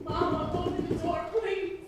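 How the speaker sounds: soft pleading at a door; apology through a door. Acted. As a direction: beg quietly to be let in. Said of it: "apology through a door".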